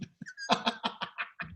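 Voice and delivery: soft, voiceless trailing laughter; real wheezy chuckling. Real, not acted. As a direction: laugh breathlessly at your own story. Teller laughs at own story.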